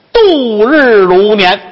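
high, slow, stretched-out moan; theatrical, long-drawn complaint; drawn-out lament. Acted. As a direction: drag out every syllable as if time crawls. Lovesick man lamenting the wait.

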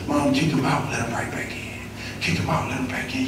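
dropped to a near whisper; low breathy retelling; hushed. Retold or imitated. Hushed retelling of mother's cycle.

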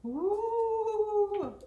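a long, high, held ooh; ghostly ooh. Real, not acted. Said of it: "Did I just sound like a ghost?".

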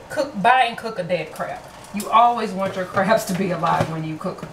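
usual speaking voice

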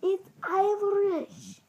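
high, drawn-out mock protest; retold indignant correction. Girl correcting her cousin about accents, mock indignant. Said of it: "I'm like".

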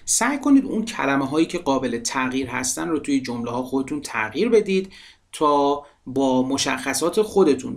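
ordinary delivery

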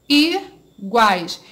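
"iguais" broken as i, pause, guais; teacher spacing out "iguais" in two syllables; split into syllables. Shown as a demonstration. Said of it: "como eu vou separar essa palavra?".

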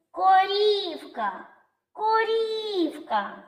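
high, very slow call of "Корівка", long І. Shown as a demonstration. Teacher calls the cow to find stress, high calling "коРІІвка". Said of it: "Покличемо корівку".

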